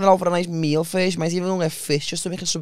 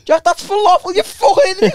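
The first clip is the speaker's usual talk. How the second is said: higher, louder mock telling-off; retold yell; 12 semitones higher, 4 dB louder than usual. Telling off his brother in a higher, louder mock yell. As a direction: bark it at your little brother. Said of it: "And I went".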